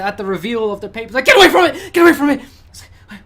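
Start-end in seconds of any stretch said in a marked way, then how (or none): 1.2-2.4 high, loud frightened yell; terrified survivor screaming; panicked scream. Acted. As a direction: scream in alarm at what you see. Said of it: "you hear a scream".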